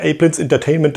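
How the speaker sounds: normal talking voice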